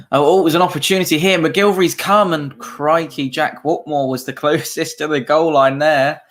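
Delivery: excited live match commentary; live play-by-play. Acted.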